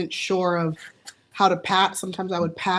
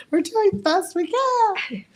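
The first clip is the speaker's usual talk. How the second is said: much higher, drawn-out squeal; high-pitched mock plea; slower than usual. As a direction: plead it high and slow, half laughing. Mock plea repeated amid laughing fit.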